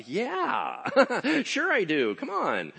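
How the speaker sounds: high, loud and laughing; hammed-up eager yes, waving them in; gleeful agreement. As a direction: play it big and generous, with a laugh. Owner gleefully offers more work.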